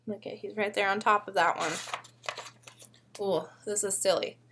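usual speaking voice

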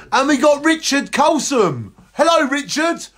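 excited greeting. Real, not acted.

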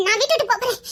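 usual speaking voice

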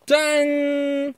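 very slow, high, stretched ta-da; ta-da reveal. Real, not acted. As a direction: draw out the reveal like a fanfare. Revealing the prize.

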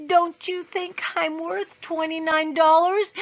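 put-on quiet child. A narrator's reading. Taya quietly asks her father, pleading. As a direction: ask Daddy gently in a high little voice. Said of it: "Then Taya quietly said".

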